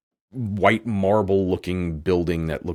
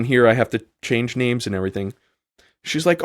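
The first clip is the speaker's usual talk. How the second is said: ordinary talk, nothing marked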